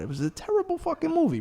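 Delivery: 3 fling the verdict up in disbelief; 1 exasperated verdict. Real, not acted. 4 telling listeners the movie was awful, high outraged pitch. Ranting about a bad movie.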